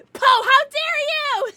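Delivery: high, loud, drawn-out mock indignation; mock outrage. Streamer teasing a chatter, mock outrage. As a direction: ham up being offended.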